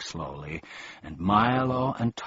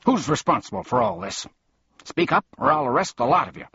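angry policeman; 10 semitones higher, 4 dB louder, faster than usual. A narrator's reading. Policeman demands answers.

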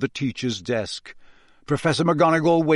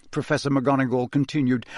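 ordinary delivery, nothing marked

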